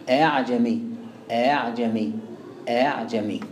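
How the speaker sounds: آه عجمي with the second hamza softened, between hamza and alif; eased hamza. Shown as a demonstration. Teacher repeating for students to hear, eased hamza in آه عجمي. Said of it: "لا تضبط إلا بالسماع".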